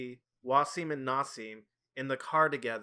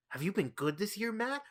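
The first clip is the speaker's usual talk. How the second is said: higher-pitched, teasing mystery caller; sinister singsong question; creepy caller impression; 8 semitones higher than usual. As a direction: imitate a creepy voice over a microphone. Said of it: "I hear in the microphone".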